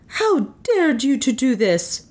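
high, angry woman's voice; acted fury, pitched high; indignant. A narrator's reading. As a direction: confront him in outrage. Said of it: "stood over him with flashing eyes".

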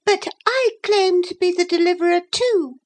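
very high, thin challenger; thin, disagreeable voice. A narrator's reading. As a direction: cut in with a high, disagreeable squeak. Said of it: "a thin and disagreeable voice".

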